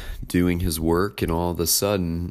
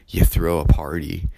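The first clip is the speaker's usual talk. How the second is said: ordinary talk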